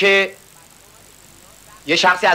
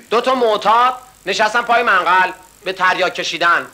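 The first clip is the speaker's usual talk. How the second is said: ordinary delivery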